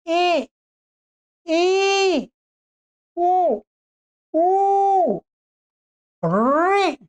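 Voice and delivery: each vowel sounded out clearly and drawn out; clear vowel drill. Shown as a demonstration. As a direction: model the vowels one by one for students. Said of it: "सीधा उच्चारण। कैसा?".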